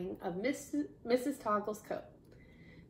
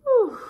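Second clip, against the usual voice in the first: short, high, loud whoop; cheering whoop; 14 dB louder than usual. A narrator's reading. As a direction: let out a celebratory whoop. Acts out everyone cheering.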